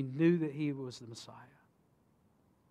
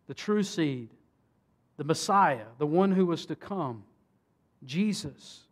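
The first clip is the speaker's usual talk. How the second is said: normal talking voice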